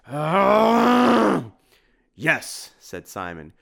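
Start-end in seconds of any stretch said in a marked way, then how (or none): 0.1-1.5 high, loud held scream; long scream. A narrator's reading. Dracula howls at Tim's awful pun, long scream. 2.2-2.8 loud, higher yes; emphatic agreement. A narrator's reading. Simon backs Tim's taunt at Dracula, emphatic agreement.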